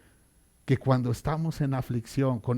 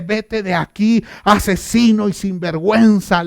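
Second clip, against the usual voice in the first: louder, higher angry outburst; angry shout; 5 semitones higher, 7 dB louder than usual. Retold or imitated. Angry man yells insults at King David, shouting. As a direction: hurl it as a furious insult. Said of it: "le gritó a David".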